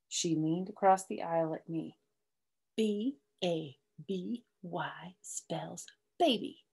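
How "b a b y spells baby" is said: slow, spelled-out whispered taunt. Acted. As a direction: whisper the letters slowly to tease. May leans over to taunt.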